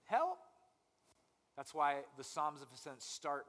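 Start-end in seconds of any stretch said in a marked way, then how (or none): 0.0-0.4 high, loud rising "Help."; loud plea. Shown as a demonstration. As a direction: call "Help." out louder and higher. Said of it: "And then just keep".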